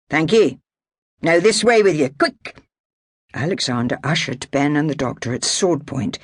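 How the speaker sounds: armed Highlander ordering his prisoners about; Highland clansman. Acted. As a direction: bark it like a Jacobite soldier. Alexander's Highland voice.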